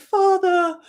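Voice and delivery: high mock cry of Father; a hammed-up call to a dying father; Luke crying out. Retelling Luke calling out to his father, mock cry. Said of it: "and he's like".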